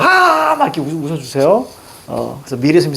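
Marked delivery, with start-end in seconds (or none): 0.0-0.5 long, high-pitched mock laugh "ah"; exaggerated burst of forced laughter; big demo laugh. Shown as a demonstration. Teacher shows students how to laugh for the school teacher, big fake laugh. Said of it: "크게 막".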